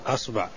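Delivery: أصبع with an opening "a": asba'; fatha on hamza. Shown as a demonstration. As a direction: say أصبع starting on a short "a". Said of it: "فتح الهمزة".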